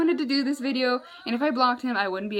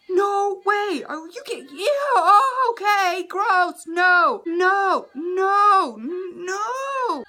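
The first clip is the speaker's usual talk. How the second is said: high, loud, wailing freak-out; horrified disgust; 8 semitones higher, 5 dB louder, much slower than usual. Retold or imitated. Vlogger relives opening a vulgar message at school, loudly grossed out. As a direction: cry out the refusals, stretching each no. I was like.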